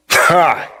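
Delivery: one high, short "ha"; scornful laugh. Acted. Mocking the street prophet, a scornful laugh. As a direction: bark out a single amused laugh.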